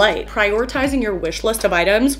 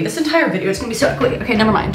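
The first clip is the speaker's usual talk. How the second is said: ordinary delivery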